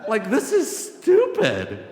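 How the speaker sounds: loud, high, drawn-out protest with a laugh; exasperated outburst. Retold or imitated. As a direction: blurt it out in comic exasperation. Mock outburst at advice.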